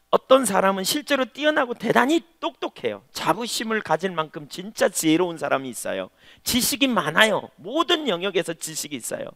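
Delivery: high, loud, ringing pulpit emphasis; preaching at full force. Acted. Pastor building to a climax before the congregation, preaching loud and high. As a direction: drive it home to the congregation.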